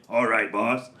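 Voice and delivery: low, soft and obedient; lowly servant voice. Pretend. His own finger replying to its boss. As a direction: answer like a loyal henchman.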